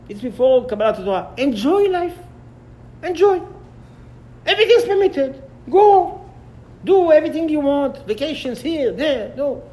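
high, loud mocking voice of the scoffers. Scoffers taunting Avraham Avinu to enjoy life, high mocking jeer.

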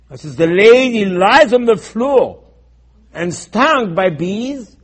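loud, high-pitched preacher's cry; full-force sermon outburst; impassioned preaching. Real, not acted. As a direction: cry it out to the congregation, stretching the last words.

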